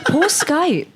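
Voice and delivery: high, cooing sympathy; mock pity. Co-host feels sorry for a deserted app, high mock pity.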